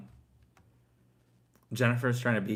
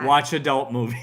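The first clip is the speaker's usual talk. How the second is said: loud, lightly voiced schoolkid; kids answering teacher; 3 semitones higher, 8 dB louder than usual. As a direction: blurt it out like a kid in class. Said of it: "A couple kids said".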